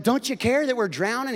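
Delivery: panicked disciples. Retold or imitated.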